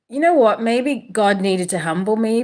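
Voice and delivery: normal talking voice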